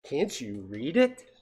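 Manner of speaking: slow, mocking question with some meanness; sarcastic. Shown as a demonstration. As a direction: stretch it out with a sarcastic bite. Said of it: "it might have been sarcastic".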